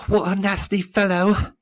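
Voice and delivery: high, loud gasp of dismay; a boy gasping out his shock. A narrator's reading. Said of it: "gasped Milo".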